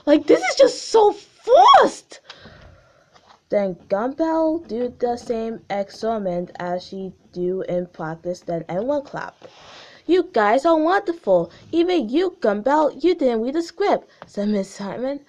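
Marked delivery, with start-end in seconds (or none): 0.1-2.2 high, loud exasperated outburst; much higher, louder fed-up exclamation. Real, not acted. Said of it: "now they're fighting". 10.1-14.1 gushing. Acted. Teacher praising loudly.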